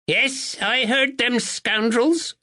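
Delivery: put-on high, slow, alert old lady; sharp old woman. A narrator's reading. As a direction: snap it out like a sharp sparrow.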